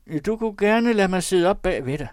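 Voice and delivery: high, loud hopeful request; tentative wheedling. A narrator's reading. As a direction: angle for a favour you don't expect. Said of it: "en tone, som viste, at han ikke ventede". (prøvende, bedende tone)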